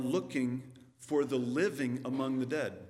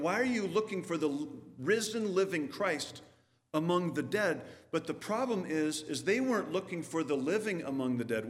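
usual speaking voice

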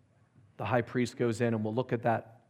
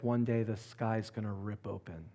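hushed retelling; 4 semitones lower, 7 dB quieter than usual. Retold or imitated. Retelling wife's picture in hush.

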